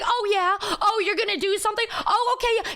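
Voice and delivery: high, taunting fake-impressed tone; high-pitched scoffing comeback; sarcastic retort. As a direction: goad them with mock belief. Her sarcastic retort to boasting.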